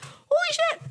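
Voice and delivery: very high pitched excited exclaim; retold excited yell. Retelling how she yelled during the last quarter, high pitched excitement. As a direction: relive yelling at the tense game. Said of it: "I was yelling".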